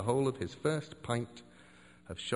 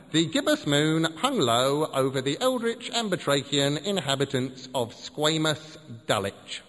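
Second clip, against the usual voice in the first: mock grand recital; 2 semitones higher than usual. Shown as a demonstration. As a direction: declaim it like purple prose. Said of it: "Ahem, ahem".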